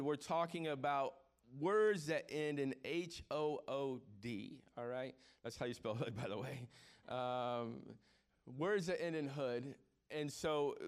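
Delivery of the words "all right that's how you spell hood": ordinary delivery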